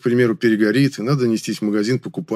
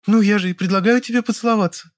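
high, would-be sexy female voice; high-pitched coquettish offer; purring; 12 semitones higher than usual. A narrator's reading. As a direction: purr it seductively up high. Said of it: "опять мурлыкнула Лёля".

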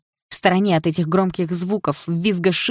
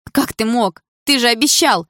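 higher, louder reproach; betrayed outburst; 7 semitones higher, 6 dB louder than usual. A narrator's reading. Betrayed girl reproaching Дима, hurt and angry. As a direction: cry out, building in force.

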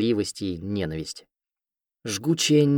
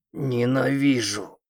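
chest growl; 4 semitones higher, much slower than usual. Acted. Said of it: "Грудной мощный рык".

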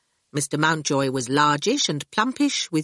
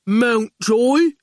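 a big, simple-minded henchman voice, a touch louder; slow hulking goon; 5 semitones higher, 4 dB louder than usual. A narrator's reading. Goon grinning at the boy outside school, lightly hulking. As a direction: loom over the boy and state it plainly.

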